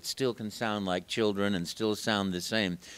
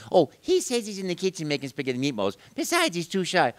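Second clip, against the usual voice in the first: put-on higher Italian accent; Mario relaying; 7 semitones higher, 3 dB louder than usual. Mario relays Luigi's excuse to the children, higher pitch. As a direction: pass the news on to the kids. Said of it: "I'd say".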